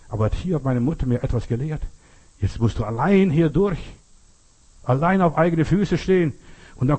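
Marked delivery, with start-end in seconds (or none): none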